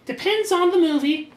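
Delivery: high put-on woman's voice; a hammed-up female voice; voicing Melanie. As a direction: pitch it way up for the girl.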